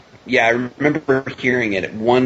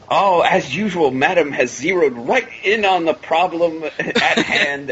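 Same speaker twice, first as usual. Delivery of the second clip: slow, higher-pitched English gentleman shtick; proper English gentleman; 6 semitones higher, slower than usual. Retold or imitated. Crook smooth-talking Cruella about the puppies, as a mock English gentleman. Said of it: "trying to be the proper English gentleman".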